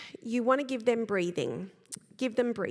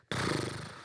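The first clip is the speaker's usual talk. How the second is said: short blown-out puff of breath; exasperated sigh; 3 dB quieter than usual. Shown as a demonstration. Coach showing what we do when annoyed, exasperated sigh. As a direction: huff it out as if fed up. Shows reaction to someone annoying.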